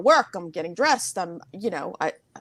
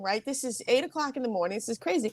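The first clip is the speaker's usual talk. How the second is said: ordinary delivery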